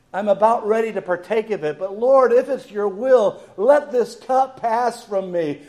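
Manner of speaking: high, intense appeal; pleading prayer. Acted. Jesus begs the Lord to let the cup pass, pleading. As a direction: plead earnestly, voice lifted and held out. Acting Jesus praying in Gethsemane.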